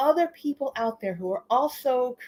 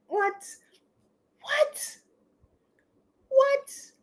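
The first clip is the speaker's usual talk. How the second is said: stunned what; 4 dB quieter, much slower than usual. Real, not acted. Staring at the deck's back.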